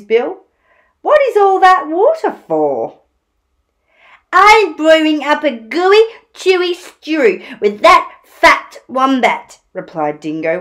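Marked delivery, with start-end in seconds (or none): 1.0-3.0 high, loud inquisitive animal voice; animated story-time question; curious Platypus. Acted. 4.3-9.6 loud, high relish; animated dingo villain for children; scheming Dingo. Acted. Dingo boasts in story.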